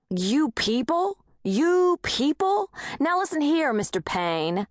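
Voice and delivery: rising outrage at an insult; outraged. A narrator's reading.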